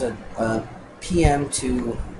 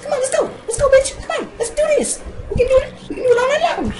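squeaky falsetto taunt, far above usual pitch; high voice; 3 dB louder, much faster than usual. Pretend. Gamer acting pissy at a creeper, in a silly high voice. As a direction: put on a very high, loud girly voice. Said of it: "That's my high voice".

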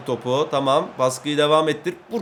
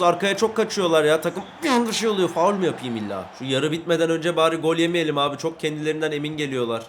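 ordinary talk, nothing marked

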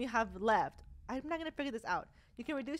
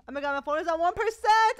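alarmed; 4 semitones higher, 9 dB louder than usual. Real, not acted. Phone battery nearly dead.

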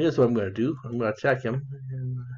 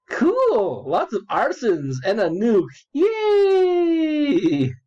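high, louder mock cheer; mock glee; 9 semitones higher, 3 dB louder, much slower than usual. Reading the rival's message aloud in mock delight, high and loud. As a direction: cheer it like a gleeful kid over a nuke.

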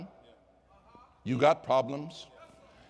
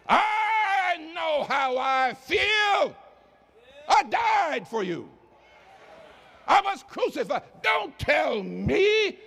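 shouting God; 9 semitones higher than usual. Acted. Preacher voices God at full force.

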